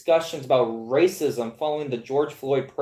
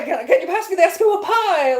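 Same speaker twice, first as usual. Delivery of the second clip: higher voice, laughing through a made-up request; laughing mock request; 13 semitones higher, 4 dB louder than usual. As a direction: giggle while asking for the pie. Mock asking for Eskimo pie.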